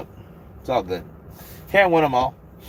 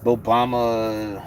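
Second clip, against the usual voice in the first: Obama impression; much slower than usual. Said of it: "Do Obama".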